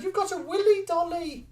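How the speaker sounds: high mock voice; quoting Jason. Friend teasing about a willy dolly, high mock voice. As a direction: squeak it out teasingly. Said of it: "Jason just said".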